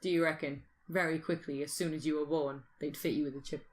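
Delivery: ordinary talk, nothing marked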